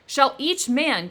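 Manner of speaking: slow, loud anguished cry; the creature crying out to Victor, slow and loud; cried out. A narrator's reading. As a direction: cry it out slowly in grief and anger. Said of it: "cried he".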